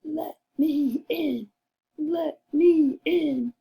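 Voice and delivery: wolf. Acted. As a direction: call slowly at the door. Wolf again.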